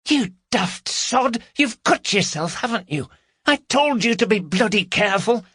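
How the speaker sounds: high, sharp alarmed scolding; panicky scolding woman. Acted. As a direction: hiss at him in a panic. Carol scolds Gary's cut.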